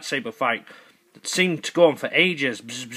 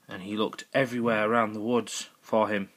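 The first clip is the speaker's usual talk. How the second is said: ordinary delivery, nothing marked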